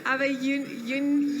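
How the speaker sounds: ordinary delivery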